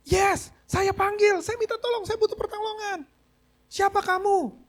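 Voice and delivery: high, loud and slow, pleading upward; desperate shouting. Retold or imitated. Man dangling in darkness shouts up to the stranger, high loud plea. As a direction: call up the cliff, begging to be heard. Calling up to voice above.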